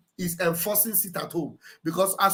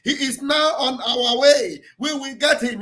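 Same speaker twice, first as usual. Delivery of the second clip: mock shouting threat; 7 semitones higher than usual. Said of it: "they must have been shouting".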